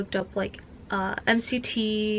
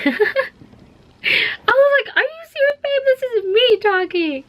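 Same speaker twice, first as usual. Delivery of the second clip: much higher, laughing through the words; laughing disbelief; 7 dB louder, slower than usual. Real, not acted. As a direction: squeal it out amused he didn't know you. Boyfriend doesn't recognise her voice.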